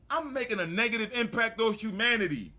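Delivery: high, loud aggrieved complaint; retold indignation. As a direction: complain to your wife, scandalized.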